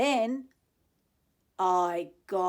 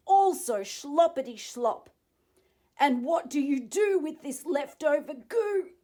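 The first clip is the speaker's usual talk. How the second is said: loud, higher scolding; angry lecturing; 5 semitones higher, 8 dB louder than usual. Acted. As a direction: tell him off with force. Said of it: "snapped the Lorax".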